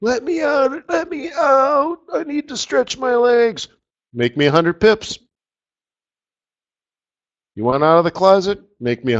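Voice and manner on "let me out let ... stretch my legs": high, slow, crying plea to be let out. Pretend. Trapped trader begs to be let out of the closet, high crying wail. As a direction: wail it like someone locked in a closet.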